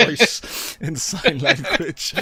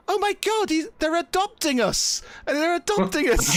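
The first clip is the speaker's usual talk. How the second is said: put-on high-pitched thrilled child; delighted kid; 14 semitones higher than usual. Kid tells the others they are being adopted, thrilled. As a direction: squeal it like a kid getting wonderful news. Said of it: "his, his eyes light up".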